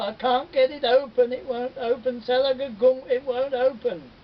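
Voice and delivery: high, loud complaint; trapped spider whining. Acted. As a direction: moan that it won't open. Spider complains it won't open.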